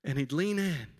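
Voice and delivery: normal talking voice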